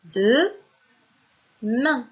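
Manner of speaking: DE, MAIN as two loud, widely separated syllables; word by syllables. Shown as a demonstration. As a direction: announce DE, pause long, then MAIN. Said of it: "c'est le mot". (« de… main », deux syllabes fortes et bien séparées)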